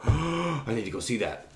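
acting out being seized by an impulse; sudden excited urge. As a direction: gasp and blurt it out. Acts sudden urge to dash off.

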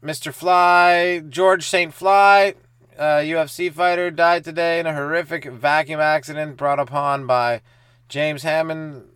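slow, loud, solemn death notice; hammed-up newsreader announcing a fly's death; mock obituary announcement. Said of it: "I'm the cause of death in the obituaries".

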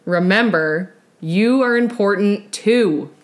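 slow, loud, polished promo read; podcast outro read. Acted. Host asking listeners for reviews, slow announcer-style outro read. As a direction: deliver a measured sign-off with emphatic key words.

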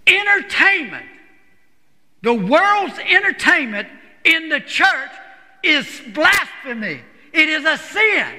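high, emphatic preacher's pronouncement; thundering verdict, each phrase set apart. Retold or imitated. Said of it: "he was emphatic about it".

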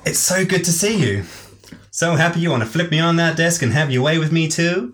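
high-pitched flirty southern belle; southern belle accent. Acted. Alicia flirts with Nicole in a southern accent. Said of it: "She is Southern Belle".

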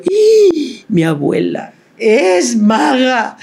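put-on high, slow, wide-eyed child's gasp; amazed little girl. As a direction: marvel like a small child seeing magic. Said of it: "y yo". (niña asombrada)

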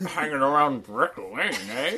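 laughing put-on London voice; mock British accent. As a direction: tease like a local bloke. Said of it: "doing a really good British accent".

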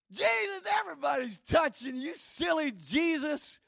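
high, teasing mock scolding; high mocking sing-song at Jesus; mocking disciples. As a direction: tease him like a silly child.

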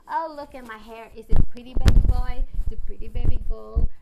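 loud teasing put-on voice; mock flirty validation-seeking voice.

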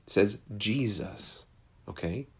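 normal talking voice

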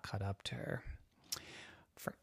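压低声音悄悄说; hushed storyteller whisper. Acted.

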